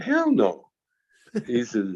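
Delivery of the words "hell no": cheeky denial. Retold or imitated. Retold cheeky reply.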